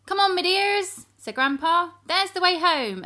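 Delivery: jolly grandfather calling; old sailor Grandpa. Acted. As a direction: call them like an old seadog. Grandpa leads them home.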